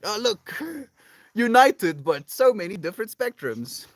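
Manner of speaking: high-pitched marvelling with a laugh breaking in; delighted mock-awe, swelling on "united"; acted wonder. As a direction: marvel out loud, pitched well up. Voices awe at seeing a rainbow.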